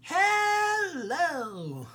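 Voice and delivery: high, drawn-out villain greeting; silky polite villain. Shown as a demonstration. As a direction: sing the greeting out like a sly villain. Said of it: "Just go".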